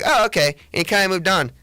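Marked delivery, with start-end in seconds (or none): 0.0-0.5 unimpressed oh okay. Retold or imitated. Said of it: "the kid was like".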